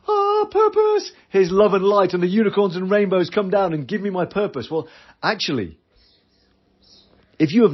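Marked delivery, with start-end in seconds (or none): 0.1-4.7 a hammed-up dreamer, sighing on purpose; mock dreamy. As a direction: float it up like someone waiting for unicorns.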